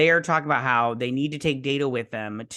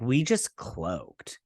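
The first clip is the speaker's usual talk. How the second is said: We just cloaked drawn out slow and low; stunned slow We just cloaked; slow, low quote; 6 semitones lower, 3 dB quieter, much slower than usual. Retold or imitated. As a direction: let We just cloaked sink slowly. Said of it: "and she's like".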